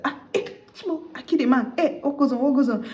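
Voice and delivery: high-pitched mock panic; panicked. Bride realising she shot the groom, panicked. Acted panic after shooting.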